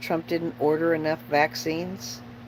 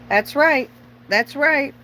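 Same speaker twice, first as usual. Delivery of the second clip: fervent agreement; 8 semitones higher, 5 dB louder than usual. Real, not acted.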